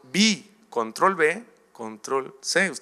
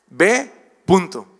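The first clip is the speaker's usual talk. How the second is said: louder, slow, stern order; firm command from God; 3 semitones higher, 6 dB louder, slower than usual. Acted. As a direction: lay down the order with no room to argue. God answers excuses firmly.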